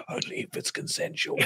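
hushed whisper underneath. Shown as a demonstration. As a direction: whisper it very softly, like a hidden backing vocal. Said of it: "then you whisper underneath".

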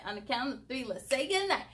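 usual speaking voice

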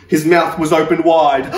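ordinary delivery, nothing marked

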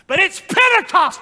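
high, loud outburst; preacher's cry. Real, not acted. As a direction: burst out to the whole congregation. Preacher cries out the occasion.

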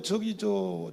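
normal talking voice

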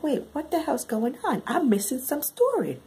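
high-pitched, bewildered outburst; baffled reaction. Retold or imitated. Reader thrown by the plot twist, baffled outburst. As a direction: pitch way up in confusion.

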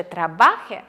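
трабахе stressed on the middle syllable, not the end; teacher demonstrating the mistake; wrong stress. Shown as a demonstration. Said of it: "не поставите на кінець і скажете".

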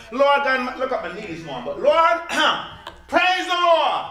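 shouted high praise despite the aches; joyful praise. Pretend. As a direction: cry out thanks to the Lord, loud and high. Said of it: "when you can get up and says".

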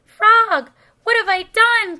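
high, loud wail of regret; distressed cry. A narrator's reading. Toad finds no answer from Frog, crying out. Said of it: "cried Toad".

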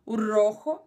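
"rojo" with a rough throaty j; "rojo" with a scraped throat sound; "rojo" with a guttural j; Spanish rojo. Shown as a demonstration. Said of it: "Ó o J aí de novo".

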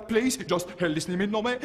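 usual speaking voice

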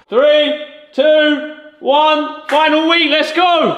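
high, loud shouted countdown; hyped countdown. Real, not acted. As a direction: count it down at full voice into a big launch. Shouted countdown to final week.

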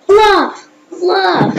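sudden high loud cry of joy; excited cheer. Real, not acted. Vlogger cheering his correct quiz guess, high excited cry. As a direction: burst out celebrating the right answer.

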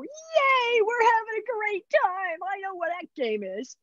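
very high, giddy dog's inner voice; happy dog voice. Retold or imitated. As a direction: voice the dog's delight, high and bouncy. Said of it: "that dog is gonna go".

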